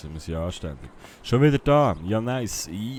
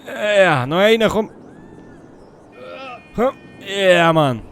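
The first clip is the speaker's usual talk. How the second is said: loud, high straining effort; grunting through the last reps; doing push ups; 6 semitones higher, 7 dB louder, slower than usual. Real, not acted. Pushing through workout reps.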